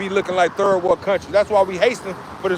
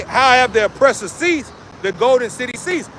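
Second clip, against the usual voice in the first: scripture reading; 4 semitones higher than usual. Acted. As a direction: declaim it like a prophet. Reads scripture aloud.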